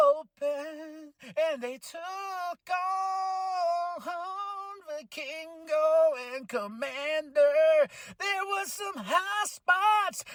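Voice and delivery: high, slow, overexcited recap; giddy falsetto. Real, not acted. Fan still overcome, recapping the match in a high slow voice. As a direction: stretch each word out in giddy awe.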